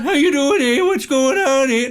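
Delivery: put-on high, loud caricature of a Canadian accent. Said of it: "Not like that".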